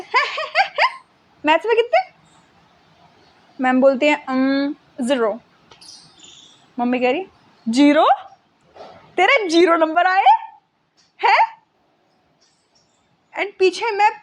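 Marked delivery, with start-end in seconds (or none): 0.0-2.0 high squeaky disbelieving "huh?" that climbs higher; shocked disbelief. Retold or imitated. Mom at the meeting hearing the score, high shocked disbelief. 4.3-5.4 slow, reluctant "um, zero"; hesitant. Retold or imitated. Teacher reluctantly telling mom the maths score, slow and hesitant. 7.7-11.6 rising, incredulous question; disbelieving mom. Retold or imitated. Mom turning to her daughter over the zero, incredulous.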